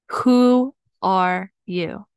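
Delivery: "Who... are... you", each word drawn out and set apart; slow and deliberate. Real, not acted. Coach posing life's simplest question, slow and deliberate.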